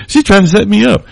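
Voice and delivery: suddenly catching on, panicked; panicked realisation. Retold or imitated. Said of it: "I was like".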